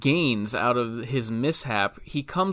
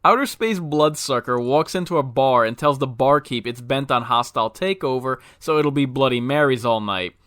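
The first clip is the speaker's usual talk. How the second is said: ordinary talk, nothing marked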